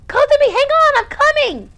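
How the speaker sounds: high, loud panicked call; loud panicky shout. Acted. Rescuer shouting to Kotomi through the flames, panicky. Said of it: "a loud and panicky voice".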